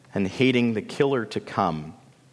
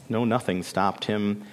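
ordinary delivery

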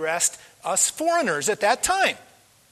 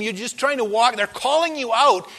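ordinary talk

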